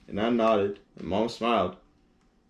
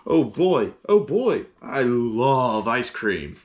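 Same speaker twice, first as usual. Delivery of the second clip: delighted elephant; 2 semitones higher, 4 dB louder, much slower than usual. Acted. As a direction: savor every word of the treat. Gerald delighted with ice cream.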